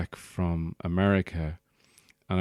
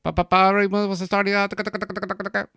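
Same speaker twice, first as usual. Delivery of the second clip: put-on much higher, louder rapid-fire north Dublin accent; spray the words out like a machine gun; hammed-up north Dublin lads rattling away; 13 semitones higher, 6 dB louder, much faster than usual. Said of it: "they just sounded like machine guns".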